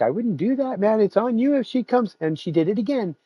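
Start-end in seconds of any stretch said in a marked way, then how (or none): 0.0-2.2 high mock warning to the cat; half-joking caution, pitched up; telling the cat off lightly. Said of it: "And I was like".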